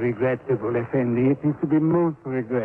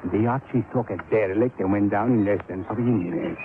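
ordinary talk

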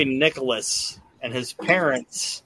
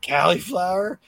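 put-on high, whiny little boy voice, rising at each end; bratty kid; 5 semitones higher than usual. Bald boy at the table whining to his parents, higher kid voice. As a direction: play the spoiled kid complaining at dinner. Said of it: "that little kid was like".

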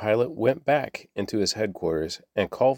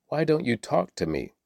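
demanding; 6 semitones higher than usual. A narrator's reading. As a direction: press for an answer, with restraint. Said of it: "Pilate demanded".

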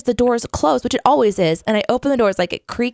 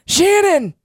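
put-on old man voice. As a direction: play a chatty old gardener. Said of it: "Have you ever heard me do my old man voice?".

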